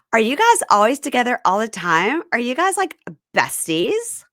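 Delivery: high, loud mock-gushing question; put-on high voice for a nosy question; Emma's prying question. Retelling Emma quizzing the friends.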